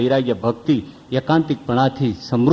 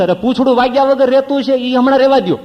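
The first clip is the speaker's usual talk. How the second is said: high, loud wincing plea; high, loud begging through pain; pained pleading; 9 semitones higher, 4 dB louder, faster than usual. Retold or imitated. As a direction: beg him to skip it, hurting.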